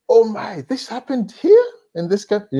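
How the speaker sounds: high, incredulous outburst; shocked anger. Acted. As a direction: act out a young person's outraged discovery. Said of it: "there's a period of anger".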